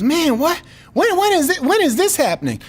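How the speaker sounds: high-pitched, incredulous quote; disbelief. Retold or imitated. Gym buddy hearing news for the first time, disbelief. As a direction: act out a friend who can't believe it.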